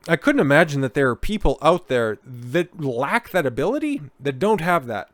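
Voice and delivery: ordinary talk, nothing marked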